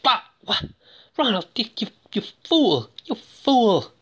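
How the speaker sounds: high-pitched, hammed-up maternal horror; horrified. Mother learns her son buried the body, mock-horrified reproach. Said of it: "the moms is horrified".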